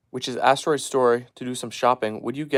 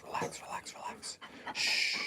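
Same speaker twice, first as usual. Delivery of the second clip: much softer, breathy whisper; whispered calming; 15 dB quieter than usual. Real, not acted. Owner whispering his dogs calm, hushed and slow. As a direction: whisper them to sleep.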